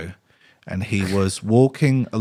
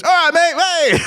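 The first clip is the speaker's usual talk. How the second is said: high, loud mock greeting; hammed-up pestering man in your face; overfriendly stranger; 6 dB louder than usual. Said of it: "he comes out and goes".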